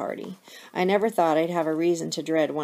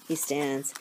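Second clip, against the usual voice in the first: ordinary delivery, nothing marked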